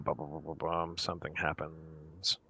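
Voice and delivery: soft, low ominous hum; ominous buildup. Acted. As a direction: hum suspense before the reveal. Ominous sound effect narration.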